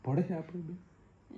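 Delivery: slow and playful, needling his wife; teasing question. Real, not acted. Husband teasing wife about getting along. (પત્નીને ચીડવતો ધીમો, મજાકિયો સવાલ)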